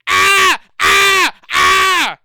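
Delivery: loud high hammed-up Ah screams; mock scream. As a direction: scream wordlessly like a ranting guest. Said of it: "If I yell and scream louder enough".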